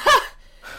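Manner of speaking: burst of laughter. Real, not acted. Reaction to the paparazzi story.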